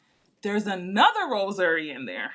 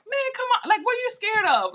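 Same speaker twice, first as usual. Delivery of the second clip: high, teasing push to come closer; mocking brother; 12 semitones higher than usual. Brother teasing her at the casket, high and pushy.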